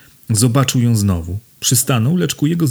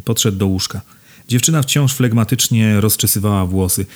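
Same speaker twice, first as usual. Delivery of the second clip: ordinary delivery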